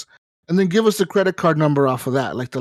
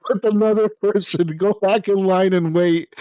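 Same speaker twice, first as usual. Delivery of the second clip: laughing; 4 semitones higher than usual. Real, not acted. Laughing about restarting the process.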